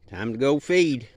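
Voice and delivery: calling out. Real, not acted. Calling out across the yard.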